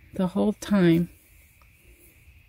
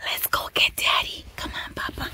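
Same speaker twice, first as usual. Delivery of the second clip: soft whispered coaxing; 7 dB quieter than usual. Real, not acted. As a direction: hush it to the dog. Whispering to dog.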